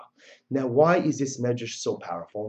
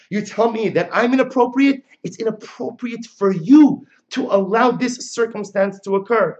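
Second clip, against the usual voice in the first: indignant bride, pitched up; outraged bride; 7 semitones higher, 5 dB louder than usual. Acted. Bride scolding the wedding guests, raised indignant voice. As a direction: scold them like a wronged bride. Voicing Chana's speech.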